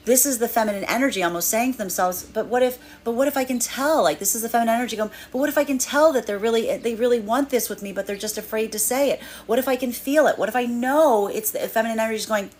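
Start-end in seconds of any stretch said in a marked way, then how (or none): 2.2-4.0 hopeful wondering. Acted. Feminine energy voiced, hopeful doubt. 5.3-11.3 hopeful wondering. Acted. Feminine energy voiced, hopeful doubt.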